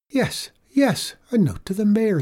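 put-on high, lively old lady; a sprightly old woman. A narrator's reading. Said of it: "said the old lady".

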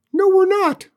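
very high, loud squeaky alien; tiny alien voice. Acted.

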